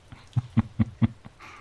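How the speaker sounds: short, breathy chuckle; quick amused laugh. Acted. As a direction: laugh lightly in little bursts. Amused by the knight.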